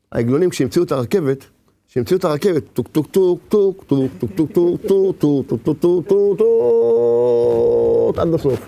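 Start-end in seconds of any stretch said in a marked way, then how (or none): 2.7-8.2 a rhythmic "tuk tuk" train sound; train chugging. Retold or imitated. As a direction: chug it out like a train. Imitating the train.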